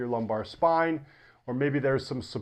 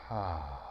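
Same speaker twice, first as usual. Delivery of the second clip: long, low, soft relaxing sigh; deep sigh; 9 semitones lower, 10 dB quieter than usual. Shown as a demonstration. Teacher showing students how to exhale, deep sigh. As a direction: let the breath fall out in a deep exhale. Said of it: "exhale with a deep sigh".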